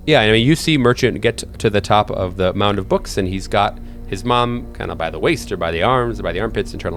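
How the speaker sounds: ordinary talk, nothing marked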